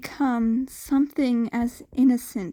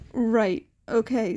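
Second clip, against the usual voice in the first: usual speaking voice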